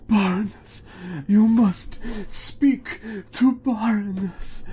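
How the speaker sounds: high, loud urgent villager voice; dwarf villager. Acted. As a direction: insist on the Baroness, high and pressing. Dwarf villager answers player.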